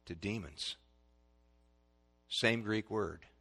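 ordinary delivery

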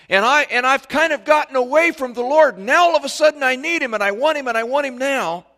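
high, loud frantic shout; desperate demand. Acted. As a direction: cry out like a child demanding help now. Acts out desperate believer demanding God.

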